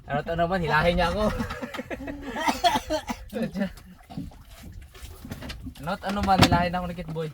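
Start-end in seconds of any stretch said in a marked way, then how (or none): 1.3-5.7 high, drawn-out amused laugh; long laugh. Real, not acted. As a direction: laugh at being towed along. Being pulled along by partner.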